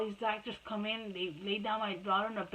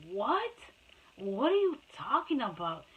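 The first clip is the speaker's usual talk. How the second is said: incredulous; 2 semitones higher than usual. Retold or imitated. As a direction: re-enact stunned, indignant questioning. Mother retells stunned reply to nurse.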